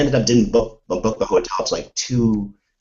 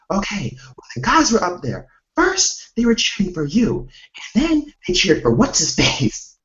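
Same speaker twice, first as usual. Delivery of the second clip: retelling friend Jordan; 12 semitones higher than usual. Retold or imitated. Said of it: "Jordan just relayed what happened".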